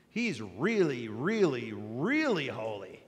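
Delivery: each really stressed, slowing on really holy; emphatic repetition. Shown as a demonstration. Preacher heaping up emphasis, slow stress. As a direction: pile up the reallys slowly. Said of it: "You're saying that God isn't just holy".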